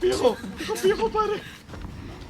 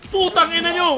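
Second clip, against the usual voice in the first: angry shout; 5 semitones higher, 7 dB louder than usual. Real, not acted. Cursing enemies loudly.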